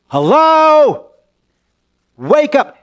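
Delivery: high, loud call to wake a sleeper. Acted. Disciples waking Jesus in the storm, loud high call. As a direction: shout up at him to wake him.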